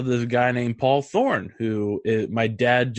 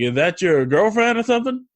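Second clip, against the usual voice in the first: imitated musician; 7 semitones higher, 4 dB louder, faster than usual. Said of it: "He's like".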